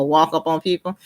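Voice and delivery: usual speaking voice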